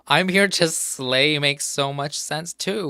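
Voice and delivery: tickled by the wrong answer; a giddy, laughing outburst; amused excitement. Real, not acted. Laughing at her own wrong guess.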